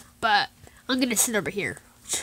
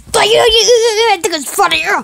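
high, loud stammering accusation; furious stuttered yell, ending in a groan; angry challenge; 9 semitones higher, 8 dB louder than usual. Acted. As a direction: explode at the one who is mocking you. Angry at being thought funny.

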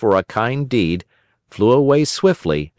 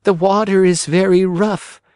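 put-on higher old-woman voice, sorrowful; little old woman; 10 semitones higher than usual. Old woman telling Pinocchio of a father at sea, worried.

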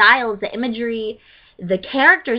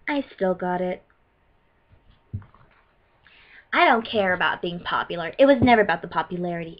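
low, self-assured villain in character; power-seeking character voice, pitched down; Arya Blaze; 5 semitones lower than usual. Acted. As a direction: speak low, as one hungry for control. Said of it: "I'm not in character anymore".

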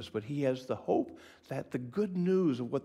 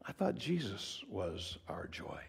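quiet objection; 4 semitones lower, 7 dB quieter than usual. Acted. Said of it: "the time out crowd says".

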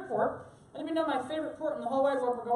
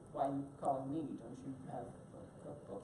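quiet retold reply; 11 semitones lower, 12 dB quieter than usual. Speaker answering the chef's phone call, low and quiet. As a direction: drop low and soft, questioning the caller. Retells his own reply to chef.

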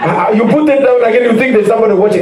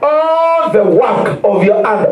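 higher, slow preaching read; fervent scripture reading; 6 semitones higher, slower than usual. Acted. Preacher reads Deuteronomy to the congregation, slow and fervent. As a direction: proclaim each blessing slowly.

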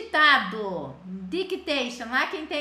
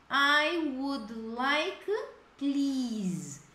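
slow, stretched polite request; slow polite model; much slower than usual. Shown as a demonstration. Teacher showing a polite order to students, slow. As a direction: lay each word out gently. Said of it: "se eu quero fazer um pedido bem educado".

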